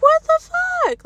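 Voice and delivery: high, drawn-out, incredulous; stunned disbelief. Retold or imitated. Thinking it when her dad demands the keys, stunned and high. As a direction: let the outrage stretch it out slowly. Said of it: "Like, I didn't say "What the fuck?".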